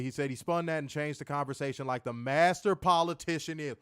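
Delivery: normal talking voice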